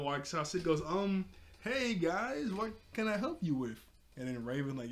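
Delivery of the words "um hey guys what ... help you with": higher, stretched-out awkward greeting; playful mock-casual stalling, pitched up; feigned innocence. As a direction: imitate someone acting innocent at the door. Starfire covering up at the door.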